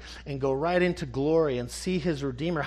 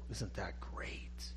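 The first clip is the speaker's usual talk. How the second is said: breathy hushed whisper, much softer and slower; whispered aside; 10 semitones lower, 16 dB quieter, slower than usual. Real, not acted. Preacher whispering to the congregation, hushed and slow. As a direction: lean in and whisper it like a secret delight.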